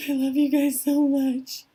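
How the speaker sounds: high, loud, choked-up gushing; hammed-up weepy outburst of affection; overwhelmed tearful gush. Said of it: "And I'm like".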